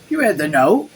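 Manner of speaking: put-on a light British bloke accent on the doctor's question. Doctor questioning the mayor's servant, in a light bloke accent. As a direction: ask it like a casual British bloke. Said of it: "I'm giving him, like, a bloke accent".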